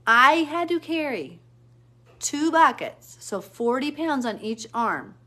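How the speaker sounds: "I had to carry" high, loud and slow; slow, forceful, high-pitched complaint; exasperated emphasis. Real, not acted. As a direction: labour every word, loud and high. Venting about carrying heavy buckets.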